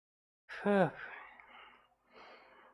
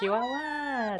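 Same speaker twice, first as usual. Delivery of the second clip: much higher squeal; imitating Kiara; 13 semitones higher, much slower than usual. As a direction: squeal the name.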